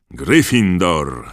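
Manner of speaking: loud, slow roar of the house name; hat roars. A narrator's reading. Sorting Hat roars Dennis's house to the hall. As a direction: let the house name boom out. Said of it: "ryknęła tiara".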